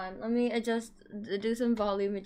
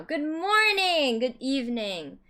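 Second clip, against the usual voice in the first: high, loud drawn-out good morning; excited greeting; 5 semitones higher, 5 dB louder, slower than usual. Acted. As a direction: belt a slow cheerful hello. Big stream greeting.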